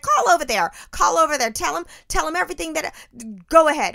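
husband egging his wife on, loud and high. Retold or imitated. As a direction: hype her up to make the call. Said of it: "he was egging me on".

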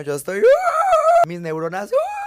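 very high, loud shriek; frantic scream. Pretend. Awake at night, frantic high scream. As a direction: scream like your brain is frying.

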